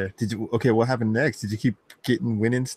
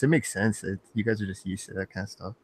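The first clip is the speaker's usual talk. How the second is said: ordinary delivery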